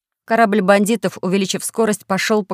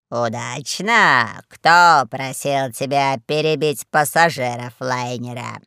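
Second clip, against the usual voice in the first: low, drawn-out incredulous rebuke; deep, slow reproachful voice; sceptical boss; 5 semitones lower, slower than usual. A narrator's reading. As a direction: stretch the question with heavy displeasure.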